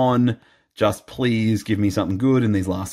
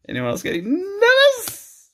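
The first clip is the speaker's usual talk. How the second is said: high squeak of mock nerves; squeaky mock nervous. Vlogger teasing viewers about the last packs, in a high mock nervous squeak. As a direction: squeak it out like a scared kid.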